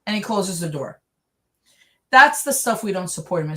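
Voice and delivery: normal talking voice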